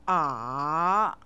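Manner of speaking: "A" dipping low then rising; third tone. Shown as a demonstration. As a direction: drop "A" down low and bring it back up. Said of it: "dips down, then rises".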